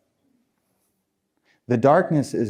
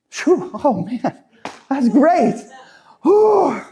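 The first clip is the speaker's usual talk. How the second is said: mock relief; 13 semitones higher, slower than usual. Mock instant relief after quitting.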